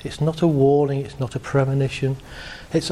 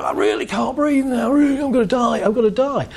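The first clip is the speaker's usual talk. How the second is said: mock panic; 6 semitones higher than usual. Voicing panicking mind.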